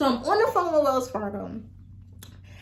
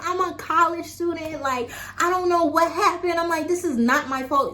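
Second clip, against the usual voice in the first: upset pleading; 6 semitones higher than usual. Retold or imitated.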